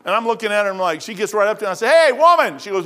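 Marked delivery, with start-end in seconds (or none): 1.9-2.6 loud, high retold hail; calling out. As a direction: hail her sharply across a street. Calls out to a woman, retold.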